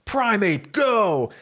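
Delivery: battle command. Acted. Trainer sends his Pokemon into battle, calling out. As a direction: call it out high, punching the last word.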